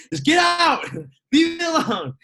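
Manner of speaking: high, loud mock yell at the roommate; retold yell. Telling the roommate to leave, a high retold yell. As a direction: shout him out of the room, half laughing.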